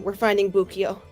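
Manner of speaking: normal talking voice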